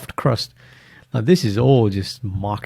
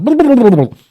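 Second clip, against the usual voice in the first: high, loud sing-song blahs; messy sing-song blah; 14 semitones higher, 11 dB louder than usual. Pretend. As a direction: sing out the mess like a jingle. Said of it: "it's like".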